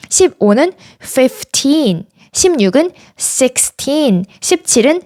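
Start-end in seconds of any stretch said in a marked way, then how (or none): 1.0-2.0 put-on slow "fifteen" with TEEN stressed. Shown as a demonstration. Teacher modelling numbers for learners, stress on final syllable. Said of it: "뒤에 나오는 틴에 강세를 주어서". 3.2-4.2 put-on slow "sixteen" with TEEN stressed. Shown as a demonstration. Teacher modelling numbers for learners, stress on final syllable. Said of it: "뒤에 나오는 틴에 강세를 주어서".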